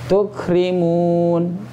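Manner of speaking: usual speaking voice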